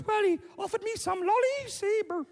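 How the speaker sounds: put-on very high little-girl voice; upset daughter. Daughter telling dad about a man, high upset voice. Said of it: "And she goes".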